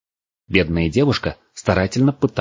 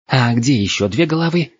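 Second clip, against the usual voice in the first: high-pitched sceptical question; disbelieving girl needling a braggart; mocking challenge; 6 semitones higher than usual. A narrator's reading. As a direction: demand proof in a high, scornful voice.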